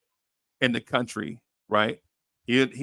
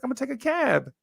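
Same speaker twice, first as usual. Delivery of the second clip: much higher, casual retold reply; lightly voiced friend, pitched up; mock friend voice; 12 semitones higher, much faster than usual. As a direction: toss it off like it's obvious.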